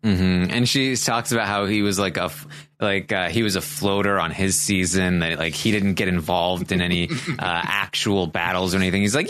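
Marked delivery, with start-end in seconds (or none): none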